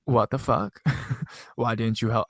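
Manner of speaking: badly faked British accent, breaking into a laugh; failed British accent. Friend asking sister for help, half-hearted British accent. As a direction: attempt British and lose it laughing. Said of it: "don't know how to do a fucking British accent".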